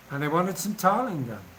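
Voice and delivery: usual speaking voice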